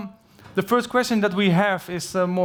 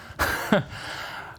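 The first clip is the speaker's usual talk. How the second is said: short airy chuckle; quick unvoiced laugh; light breathy laugh; 6 dB quieter than usual. Real, not acted. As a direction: laugh softly at your own joke. Laugh after own joke.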